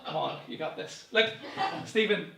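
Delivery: normal talking voice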